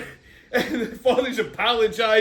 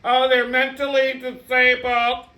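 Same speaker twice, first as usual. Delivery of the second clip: slow, higher-pitched mock-pious voice; mocking jerk voice; 5 semitones higher, slower than usual. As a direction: draw it out to mock the oversensitive. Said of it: "like, jerk offs like".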